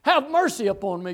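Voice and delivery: loud, high plea for mercy; crying out. Acted. Blind man calling to Jesus for mercy, crying out. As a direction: call it out over a crowd.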